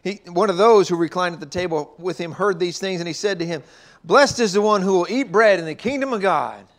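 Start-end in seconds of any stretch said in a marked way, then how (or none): none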